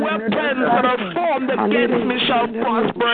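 rising, impassioned prayer voice building toward a climax; fervent prayer. Real, not acted. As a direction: church prayer leader pouring out thanks.

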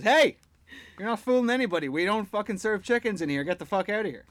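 loud, high, angry barman telling a chicken to leave. Retold or imitated. Barman yelling at a chicken to get out, loud and angry. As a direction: bark it like a fed-up barman throwing out a regular. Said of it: "He goes".

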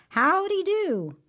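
high, loud, slow called-out howdy; hearty greeting. Acted. Brer Rabbit calling out to the tar baby, loud cheery hail. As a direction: hail someone down the road.

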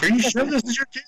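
high teasing question; mock doubting. Wrestlers teasing the mother, high mock doubt. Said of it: "we were like".

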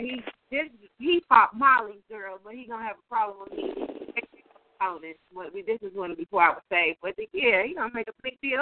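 ordinary delivery

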